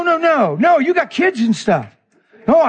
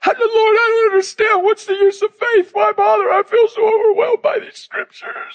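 much higher, breaking with tears; crying prayer; 11 semitones higher than usual. Retold or imitated. Preacher reliving his meltdown on the bed, high tearful plea. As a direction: cry out to God, overwhelmed and pleading. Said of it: "I am melting down".

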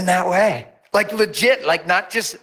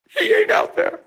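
put-on much higher girlish retort; imitating her insistence. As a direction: snap it back like the upset girl. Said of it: "She's telling me".

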